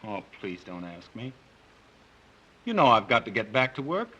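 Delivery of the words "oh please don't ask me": soft, uneasy plea; pleading softly. Acted. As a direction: beg her quietly not to ask. Husband begs wife to stop.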